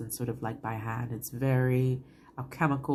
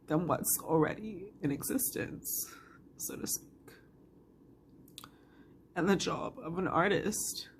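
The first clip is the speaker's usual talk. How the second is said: real tearful voice, pitched up and faltering; choked up, fading at phrase ends; getting emotional; 3 semitones higher than usual. Real, not acted. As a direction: struggle through the words while crying. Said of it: "this is why I'm getting emotional".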